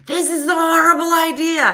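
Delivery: high, loud cry of protest; mock panicked yell. Partner protesting from the far trapeze, mock panicked yell.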